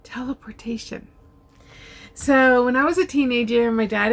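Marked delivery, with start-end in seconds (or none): 0.0-1.1 breathy whisper of "Teleportation", voice gone; whispered answer. Real, not acted. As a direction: hush it out like a secret.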